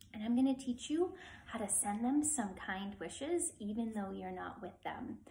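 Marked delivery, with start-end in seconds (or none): none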